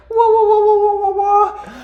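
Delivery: very high, loud wavering warble; mock sci-fi stock sound; UFO sound effect. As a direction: imitate a cheesy flying saucer. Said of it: "U F O sound effects".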